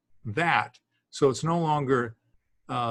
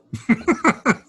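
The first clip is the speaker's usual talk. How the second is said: high bright laugh at his own joke; burst of laughter; 11 semitones higher, 5 dB louder than usual. Real, not acted. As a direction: laugh out at the absurdity.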